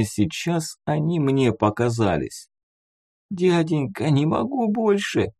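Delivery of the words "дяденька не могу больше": put-on high, plaintive child voice; wheezing worn-out boy. A narrator's reading. Exhausted boy begging the man to stop, high wheezy plea. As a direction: plead in a high, spent little boy's voice. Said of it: "просипел паренёк".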